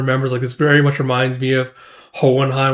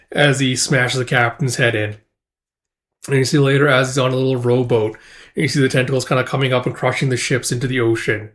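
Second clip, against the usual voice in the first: usual speaking voice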